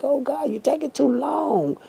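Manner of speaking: mock complaint to God, pitched high; impatient plea. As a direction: whine it upward as an imitated impatient believer. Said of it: "And it seems like".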